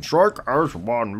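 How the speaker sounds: slow, put-on soldier voice; mimicked game soldier. Player copies the game unit's line, slow put-on voice. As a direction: drag the battle line out in imitation. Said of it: "What that dude said".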